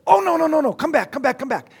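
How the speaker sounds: high, loud excited call, easing off; urgent calling back. Retold or imitated. Lecturer calling his dismissed class back, high and urgent. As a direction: call them back in a sudden high burst.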